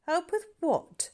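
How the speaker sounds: high, slow tart question; sharp and unimpressed; Sandy sceptical of the boy's help; tartly. A narrator's reading. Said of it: "said Sandy tartly".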